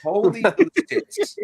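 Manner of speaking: high, slow, savoring each word; delighted exclamation. Pretend. Co-host repeats the Mountie line, high and delighted. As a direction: relish the silly Mountie line, drawn out.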